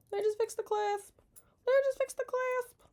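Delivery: very high squeaky repeat; squeaky echo. Acted. Repeating herself about fixing the clasp, squeaky.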